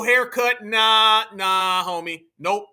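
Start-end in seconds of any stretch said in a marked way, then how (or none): none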